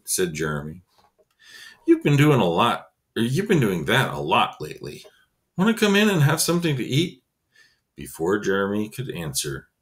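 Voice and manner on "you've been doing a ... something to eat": put-on high boyish voice, fast near the end; lightly raised kid voice, friendly invite; cheerful neighbour boy offering food; Specimen voiced. Specimen's lines in a story.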